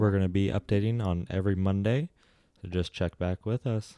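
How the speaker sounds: usual speaking voice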